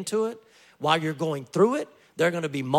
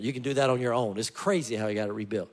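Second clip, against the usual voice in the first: normal talking voice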